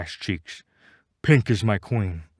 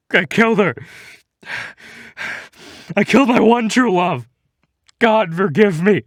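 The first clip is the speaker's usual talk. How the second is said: much higher and louder, voice cracking in mock grief; hammed-up sobbing; 12 semitones higher, 8 dB louder than usual. Grieving gamer wails over killing Pink, hammed-up sobbing. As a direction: wail it out like an overacted breakdown. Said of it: "I break down sobbing".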